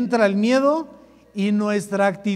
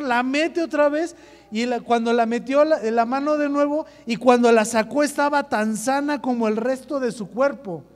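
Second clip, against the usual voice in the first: ordinary talk, nothing marked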